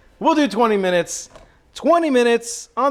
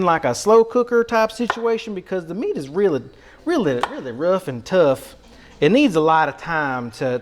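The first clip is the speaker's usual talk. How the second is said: put-on Southern accent. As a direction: play a folksy North Carolina Southerner. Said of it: "set off the stereotype".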